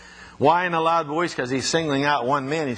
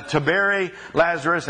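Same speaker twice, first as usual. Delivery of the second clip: ordinary delivery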